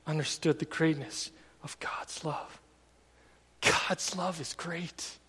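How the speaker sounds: hushed, slow whisper of awe; preacher letting the truth sink in, whispered; awed whisper. Real, not acted. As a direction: breathe it out in wonder, barely voiced. Awed reflection on God's love.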